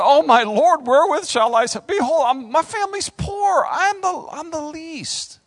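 hammed-up, climbing wail of inadequacy; fearful Gideon. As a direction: plead in mock panic as the least likely hero. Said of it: "that's fear".

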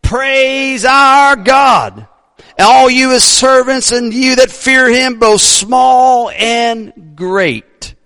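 high, drawn-out proclamation from the throne; slow, sustained ringing shout; booming heavenly voice. Acted. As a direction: call it out like heaven's choir director. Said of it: "a booming voice".

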